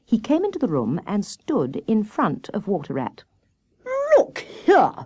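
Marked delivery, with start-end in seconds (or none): 4.2-4.9 high, affronted outburst; huffy hare's challenge; indignant cry. A narrator's reading. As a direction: protest sharply in a high voice. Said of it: "he cried".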